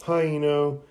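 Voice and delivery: mock call-out at moderate volume. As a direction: hail a friend, lightly acted. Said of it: "one of the boys calls out".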